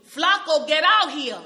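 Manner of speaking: loud shout of a name; mother yelling. Retold or imitated. Mother calls her son in, a loud yell. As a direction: yell from the next room. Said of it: "she yells to me".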